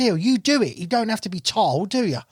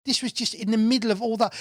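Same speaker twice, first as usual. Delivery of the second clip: ordinary talk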